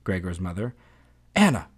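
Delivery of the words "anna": sharp, higher-pitched calling shout. A narrator's reading. Father calls into the kitchen, shouting. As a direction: call the maid's name out in short raised bursts. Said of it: "the father shouted into the kitchen".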